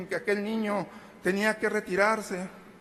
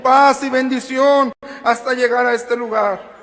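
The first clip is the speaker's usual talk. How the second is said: loud, higher, ringing proclamation; preaching at full force; 5 semitones higher, 13 dB louder than usual. Acted. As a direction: proclaim it loudly to the whole congregation. (predicación a toda voz)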